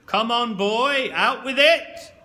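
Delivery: put-on furious teacher shouting at a boy; parade-ground shout. A narrator's reading. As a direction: bellow at him like a recruit. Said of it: "as though I was in a platoon".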